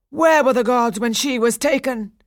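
high, loud bitter challenge. A narrator's reading. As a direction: throw the question back bitterly. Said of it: "Daphnis said bitterly".

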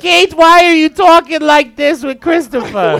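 high, slow scolding mom voice; imitating Anita. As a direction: scold like a shocked mother. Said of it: "make an Anita soundboard".

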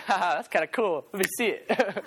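chuckling, curious and amused; amused Pharisee. Pretend. Pastor imagining himself as a Pharisee, chuckling and curious. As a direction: laugh it off, intrigued. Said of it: "I'd be like".

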